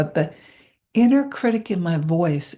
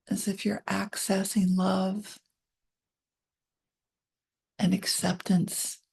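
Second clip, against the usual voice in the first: gentle guided relaxation read; guided meditation; 2 semitones higher, 3 dB quieter than usual. Acted. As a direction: ease them down into calm.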